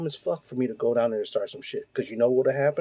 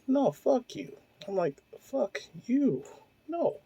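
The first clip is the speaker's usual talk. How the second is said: high, pitched-up mock snap; retold angry rejection; 10 semitones higher, 5 dB quieter, slower than usual. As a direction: fling it back, voice up high.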